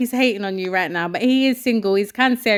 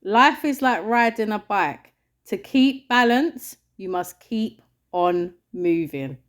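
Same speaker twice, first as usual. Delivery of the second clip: slow, measured quote reading; quote recital; 4 semitones lower, slower than usual. Real, not acted. Co-host presenting the quote of the week, slow recital. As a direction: deliver it slowly, pausing between phrases.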